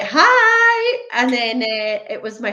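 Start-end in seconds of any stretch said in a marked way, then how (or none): 0.0-1.0 imitating an unsuspecting call pick-up, pitched way up; loud high-pitched hello; acted phone greeting.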